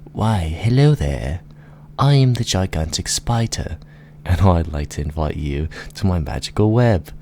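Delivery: theatrical storybook spider; inviting spider. Acted. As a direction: greet them sweetly as a giant spider luring guests. Plays the gigantic spider.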